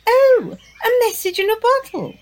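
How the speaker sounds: high, bright excited gasp; delighted gasp. Acted. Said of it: "Diva gasped with excitement".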